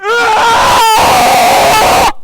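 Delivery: long, very high, loud scream; hammed-up shriek of alarm; panicked scream. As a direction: scream at full volume in mock horror. Americans acted screaming in alarm.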